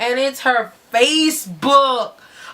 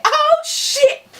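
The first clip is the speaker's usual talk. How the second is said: surprised. Retold or imitated. Narrator's own surprised reaction.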